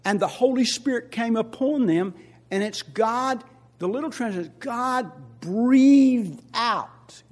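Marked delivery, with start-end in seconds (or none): 4.6-7.2 breathed stretched very slow and louder; drawn-out breath. Shown as a demonstration. Pastor illustrating God breathing out, very slow. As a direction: draw out breathed like an exhale.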